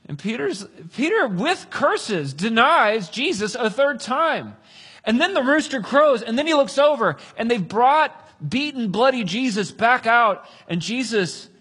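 high, loud, slow dramatic build; full-force preaching. Real, not acted. Preacher tells the third denial, loud impassioned delivery. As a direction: hammer out each phrase to the congregation.

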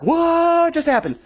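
high, drawn-out startled question; mock startled student. Students surprised when the teacher appears behind them, mock startled. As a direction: play a confused kid caught off guard. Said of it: "they'll be like".

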